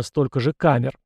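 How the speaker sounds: plain reading voice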